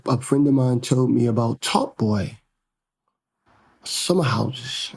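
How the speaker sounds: usual speaking voice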